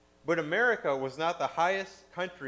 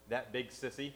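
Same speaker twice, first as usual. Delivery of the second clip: a hint of a small, shaky child; lightly voiced scared little boy, softer; 2 semitones lower, 7 dB quieter than usual. A narrator's reading. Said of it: "a shaky little voice".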